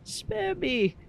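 high, wailing sobbed pleas; uncontrollable sobbing. Acted. Mick alone, pleading for it to end, sobbing uncontrollably. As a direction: beg through sobs, pitch far up. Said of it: "uncontrollably starts to sob".

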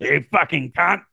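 high mock-Australian insult; hammed-up Australian accent. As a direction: hurl it in broad comic Australian. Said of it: "got an Australian accent".

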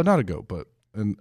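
ordinary delivery

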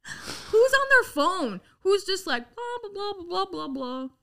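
mock the cop chattering on his phone; annoyed mimic. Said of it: "That's what pissed me off".

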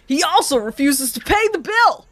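mock stand-up comic. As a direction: ham up the special bit.

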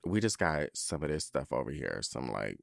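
low, soft, indifferent clerk; store clerk. Retold or imitated. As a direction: imitate an unhelpful shop worker, voice dropped low. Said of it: "they're like".

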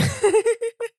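sudden high laugh; high burst of laughter. Real, not acted. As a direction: laugh out loud. Laughs at cohost chugging water.

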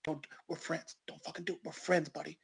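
urgent hushed pleading whisper; desperate whisper. Retold or imitated. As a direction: beg under your breath so mom can't hear. Homeless guy pleading behind Bobby.

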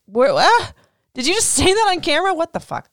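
stunned, half laughing; shocked disbelief. Real, not acted. Viewer shocked Raquel admitted it on camera, incredulous.

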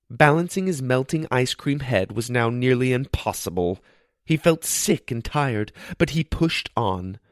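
usual speaking voice

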